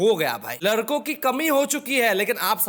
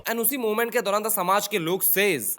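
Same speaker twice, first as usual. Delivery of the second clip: ordinary talk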